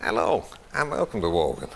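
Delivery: chat-show host impression, swinging in pitch; put-on host patter with pauses; Terry Wogan. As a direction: welcome the audience as a rambling TV presenter. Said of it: "You did a great Wogan".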